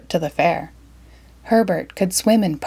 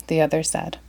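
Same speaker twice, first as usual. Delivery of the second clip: ordinary delivery, nothing marked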